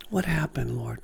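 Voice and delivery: hushed prayer. Retold or imitated. Man asking the Lord in wonder, hushed and awed. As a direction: ask it in quiet awe.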